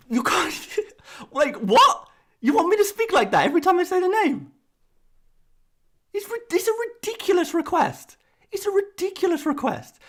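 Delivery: very high-pitched, exasperated squawk of disbelief; incredulous protest. Real, not acted. YouTuber objecting to speaking French for every name, high and incredulous. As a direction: protest the absurd demand, voice soaring high. Incredulous rant at absurd demand.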